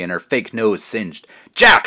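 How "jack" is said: much higher and louder angry yell of his name; furious roar. Acted. Angry witch roars at Jack, pinned to the wall. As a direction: roar it, shoving him to the wall. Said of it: "she roared".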